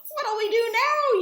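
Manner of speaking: loud, high panicked yell. A narrator's reading. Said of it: "yelled one of the pirates".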